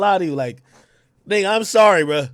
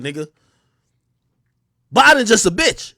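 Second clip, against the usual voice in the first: usual speaking voice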